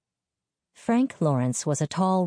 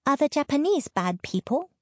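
put-on high-pitched little girl's question; child's voice, much higher; 12 semitones higher than usual. A narrator's reading. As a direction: ask it high, like a small child. Small child asks her mother.

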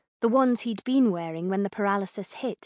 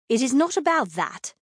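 pitched-up, emphatic protest; defensive denial; 4 semitones higher, 4 dB louder than usual. A narrator's reading. Althea rebutting the king's taunt, telltale defensive denial. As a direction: deny it a bit too firmly. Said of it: "in a tone that made it clear it was about that exactly".

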